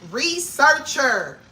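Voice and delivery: high, thrilled daydream; picture your future, thrilled; excited dreaming. Retold or imitated. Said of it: "I'm like".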